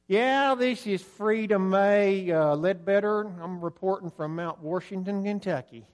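hammed-up Deep South accent. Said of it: "anybody with a Deep South accent".

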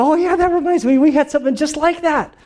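very high, eager squeal of recognition; excited sharing. Acted. Disciples swapping stories around Jesus, high-pitched acted excitement. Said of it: "like, excitement and passion".

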